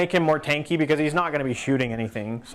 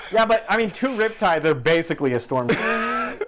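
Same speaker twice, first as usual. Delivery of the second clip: high, loud, laughing plea; amused haggling, pitched up and slowed; laughing protest; 9 semitones higher, 6 dB louder than usual. Real, not acted. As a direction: argue back through real laughter. Laughing while haggling over waters.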